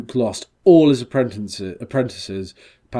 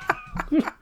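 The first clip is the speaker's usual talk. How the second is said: high laugh; 6 dB quieter than usual. Real, not acted. Laugh in the put-on voice.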